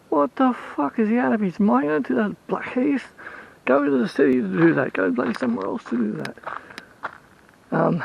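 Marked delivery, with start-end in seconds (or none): 0.1-6.4 higher, mock outraged rant; Rain's outraged rant. Friend Rain appalled at the neighbour's building plans, outraged. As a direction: sound appalled on Rain's behalf. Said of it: "Rain was like".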